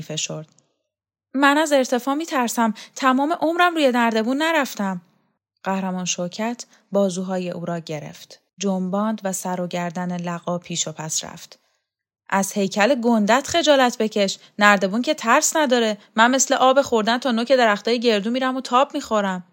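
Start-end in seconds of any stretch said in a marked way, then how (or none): 1.3-5.0 higher-pitched worried protest; anxious underling. Acted. 12.3-19.4 loud, higher, boastful scolding; bossy scolding woman. Acted.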